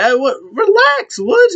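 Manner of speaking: high-pitched mock plea to calm down; defensive protest. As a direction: imitate a flustered partner, voice shooting up. Kofi calming angry partner, retold.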